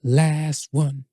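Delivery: slow, spaced-out announcement; draggy, slow question intro; odd drawn-out delivery. Real, not acted. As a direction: stretch the words out lazily. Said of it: "I don't know why I'm talking like this".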